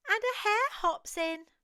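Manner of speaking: ordinary delivery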